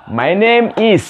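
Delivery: MY NAME IS pitched high and measured; model for repetition. Shown as a demonstration. Teacher models the answer to repeat, high and clear. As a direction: lift each word clearly for learners. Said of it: "repeat after me".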